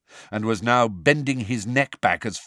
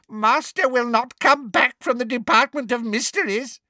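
high gleeful elf voice; 3 dB louder than usual. A narrator's reading. Elf gleefully says the master won't return, creature voice. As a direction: crow it with spiteful glee. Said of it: "he said gleefully".

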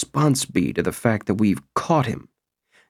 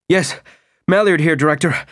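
out of breath, agitated and louder; puffing out each word; 6 semitones higher, 4 dB louder than usual. A narrator's reading. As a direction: puff out each word, winded and stressed. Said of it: "a puff-puffing voice showing great agitation and stress".

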